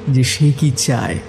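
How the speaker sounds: plain reading voice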